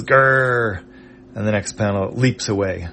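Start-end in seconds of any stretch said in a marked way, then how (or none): 0.0-0.8 mock growl. Robot mouse to Nog, clenching its fists: a short angry growl. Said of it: "it clenches its fists and says".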